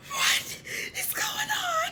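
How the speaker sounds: very high, slow drawn-out squeal; starstruck shriek. Retold or imitated. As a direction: stretch the words into a disbelieving shriek. Acted shock at seeing a star.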